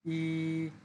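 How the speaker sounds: ই held level and drawn out; Mandarin first tone. Shown as a demonstration. Teacher shows the first tone on ই, level. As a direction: sustain ই on one pitch. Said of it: "টেনে বলতে হবে".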